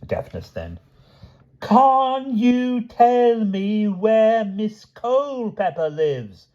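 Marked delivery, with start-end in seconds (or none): 1.6-6.5 high, very slow and loud, every word stretched; loud, drawn-out repeat; louder. A narrator's reading. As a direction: shout it slowly to a deaf man. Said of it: "repeated her question ... even louder".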